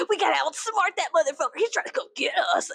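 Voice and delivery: high-pitched, hammed-up urgency; mock alarm. American plotting to outwit death, high mock alarm. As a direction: imitate a worked-up American scheming against death.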